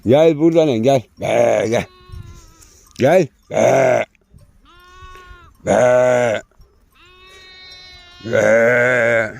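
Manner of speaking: high, loud calls across the pasture; calling the dog. Real, not acted. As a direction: yell for the dog to come back, again and again. Shepherd calls and scolds the dog.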